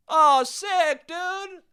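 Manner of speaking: high-pitched mocking gamer hype; put-on high fanboy voice; mock excited fan. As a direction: squeal it like an overexcited fanboy. Mocks excited Half-Life fans.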